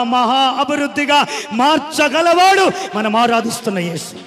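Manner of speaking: fiery preacher proclaiming the miracle; preaching at full force. Acted. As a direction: thunder it out to the congregation. Preacher building to a climax.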